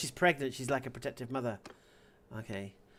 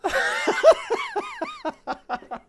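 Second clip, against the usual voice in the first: loud, high-pitched cackling laugh; real laughing fit; burst of laughter; 9 dB louder than usual. Real, not acted. Cracks up before sending reply.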